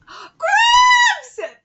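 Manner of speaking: very high, loud drawn-out cry; gasp, then shriek it in comic disbelief; outraged yell. Acted. Reacting to being called the nickname, shrieking in disbelief.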